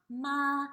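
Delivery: "ma" held high and flat; first tone. Shown as a demonstration. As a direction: stretch "ma" on a level note. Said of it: "it's a high flat tone".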